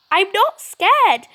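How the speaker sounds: high, loud, slow forced bravery; insisting not scared. Acted. Frightened child insisting to her friend she is brave, high and slow. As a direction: say it to convince yourself.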